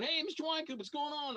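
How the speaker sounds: cheery fan greeting. Retold or imitated. Mock fan hailing the actor at his table. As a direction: mimic an eager fan calling out. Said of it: "they're going".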